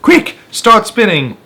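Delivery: urgent shout. Acted. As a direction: tell her to hurry, loudly. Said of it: "yelled James".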